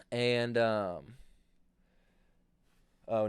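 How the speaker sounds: ordinary talk, nothing marked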